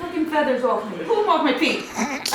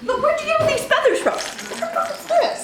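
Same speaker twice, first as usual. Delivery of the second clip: laughing disbelief; 13 semitones higher than usual. Real, not acted. Mom laughing at feathers.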